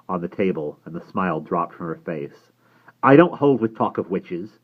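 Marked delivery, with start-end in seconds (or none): 3.0-4.6 louder, higher, firm disapproval; polite but firm. A narrator's reading. Cornish woman objecting to talk of witches, politely but firmly. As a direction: state it firmly, staying polite. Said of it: "she said politely but firmly".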